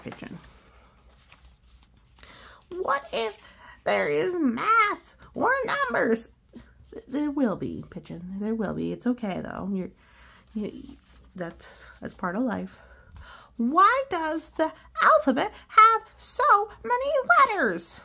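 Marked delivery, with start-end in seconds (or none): 2.7-6.3 high, fretful bird voice; worried cartoon pigeon asking. Adult voices Pigeon in story. 13.6-18.0 high, fretful bird voice; worried cartoon pigeon asking. Adult voices Pigeon in story.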